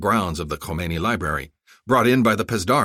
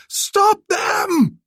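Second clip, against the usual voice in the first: much higher, louder scream; screamed command; 7 dB louder than usual. Acted. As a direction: scream at every unit. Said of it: "he screamed".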